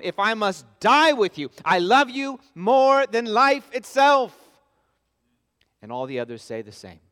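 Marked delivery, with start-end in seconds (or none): 0.0-4.4 louder, slower, pressing each word; Peter's vow, preached emphatically; emphatic. Retold or imitated. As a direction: declare it with full conviction. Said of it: "He says emphatically".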